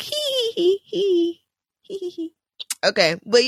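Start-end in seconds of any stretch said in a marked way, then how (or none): 0.0-2.3 high squeaky hee hee giggle, put on; mock spray bottle laugh. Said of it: "Now I have to be like".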